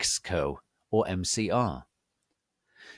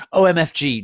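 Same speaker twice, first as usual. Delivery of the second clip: excited squeal; 8 semitones higher, 11 dB louder, faster than usual. Acted. Author's note fangirl squeal.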